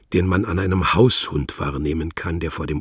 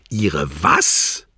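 guttural, louder outburst; 6 dB louder than usual. Acted. Wladimir cuts Worlock off, guttural and louder. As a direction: bark it from the throat. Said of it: "in zentralasiatischen Kehltönen".

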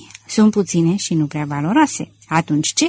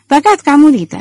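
higher, louder mocking remark; sneering; 7 semitones higher, 7 dB louder than usual. A narrator's reading. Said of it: "rânji domnul Filler".

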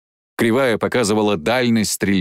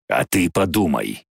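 dragon's retort; 5 semitones lower than usual. Acted. Dragon answers Jane.

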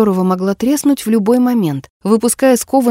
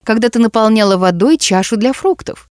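ordinary delivery

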